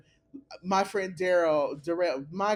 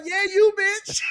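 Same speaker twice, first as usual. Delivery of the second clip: high, loud sassy comeback; sassy reply; 11 semitones higher, 6 dB louder than usual. Retold or imitated. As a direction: snap back at the squirrel. Girl answers squirrel.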